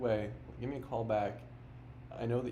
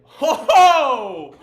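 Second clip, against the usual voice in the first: laughing; 13 semitones higher, 13 dB louder than usual. Real, not acted. Laughs at the hang-up.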